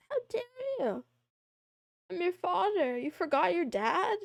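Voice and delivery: mock outraged father.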